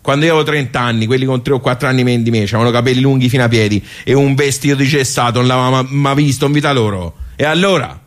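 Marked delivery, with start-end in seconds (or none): none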